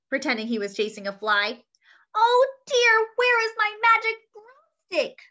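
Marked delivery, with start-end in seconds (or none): 2.2-5.2 put-on high, loud distressed old witch; crying out witch. A narrator's reading. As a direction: wail it like a panicked witch. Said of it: "the old witch cried".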